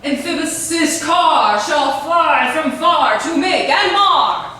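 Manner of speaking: slow, booming hammy verse; ranting tyrant. Acted. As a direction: declaim like a raging tyrant. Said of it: "This is not me".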